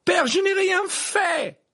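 very high, loud desperate wail; slow, frantic high shriek; piercing cry. Acted. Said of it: "s'écria le garçon d'une voix perçante".

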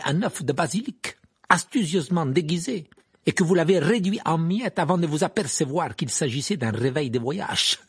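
high, loud sneering tale; enjoy humiliating him; mocking Karkaroff. A narrator's reading. Karkaroff se moque de Maugret. (Karkaroff moqueur)

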